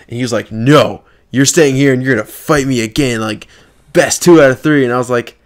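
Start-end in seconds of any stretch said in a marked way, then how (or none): 0.5-4.8 loud imitation of a demanding kid; insistent demand. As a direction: insist loudly, mimicking a sore loser.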